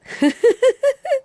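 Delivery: high, bright burst of laughter. Real, not acted. Host laughing at a listener's cheeky haiku.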